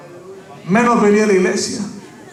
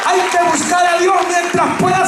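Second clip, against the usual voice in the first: preaching at full force; 7 semitones higher than usual. Acted. Pastor to his church, preaching at full force. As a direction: lift the congregation with a booming shout.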